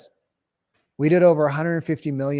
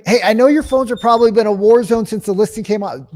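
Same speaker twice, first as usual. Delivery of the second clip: higher, louder, upbeat cold-call opener; phone pitch demo; 6 semitones higher, 7 dB louder than usual. Shown as a demonstration. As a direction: sell it with energy on the phone. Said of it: "Do you hear the pace that I'm talking?".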